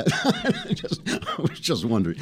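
words broken by real laughter; giggling through it; cracking up. Real, not acted. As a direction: try to finish the sentence while laughing. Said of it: "without cracking up".

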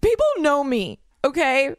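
mock diva demand; self-important. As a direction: huff it out like a celebrity insisting she matters. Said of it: "I'm like".

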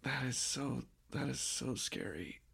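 barely above a breath, genuinely unsettled; hushed and scared. Real, not acted. Reacting to a scary ghost story.

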